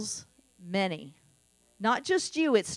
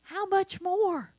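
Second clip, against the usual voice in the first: weary plea; 5 semitones higher, much slower than usual. Retold or imitated. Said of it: "and I'm like".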